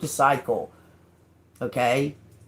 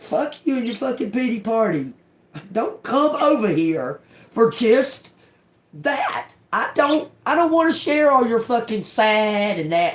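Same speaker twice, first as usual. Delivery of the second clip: higher, heated rant swearing them away; angry telling-off; 5 semitones higher than usual. Retold or imitated. Telling off friends who bring pity parties, furious rant. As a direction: shove the pity party off with disgust. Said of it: "You're like".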